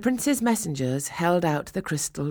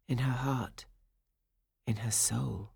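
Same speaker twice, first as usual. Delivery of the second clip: hushed; 4 semitones lower, 7 dB quieter than usual. A narrator's reading. Dreamy narration fades to a hush.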